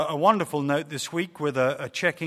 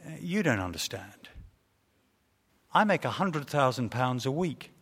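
lower, softer, unbothered reply; very cool; 3 semitones lower, 4 dB quieter than usual. Acted. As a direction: laid back as anything, totally unconcerned. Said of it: "the second man replied very coolly".

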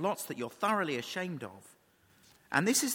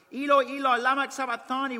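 raised, higher crying-out voice; loud cry; 7 semitones higher, 5 dB louder than usual. Retold or imitated. Preacher quoting Jesus's cry from the cross, loud cry. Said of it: "cried out in a loud voice".

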